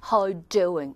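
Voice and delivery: restrained, low-key British greeting; understated British "How you doing"; British accent. Shown as a demonstration. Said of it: "영국 사람들이 이런 게 좀 덜해요".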